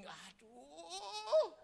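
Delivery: high wailing aduh; high comic groan; mock dismay. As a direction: wail aduh in comic horror. Dismay at spilled food.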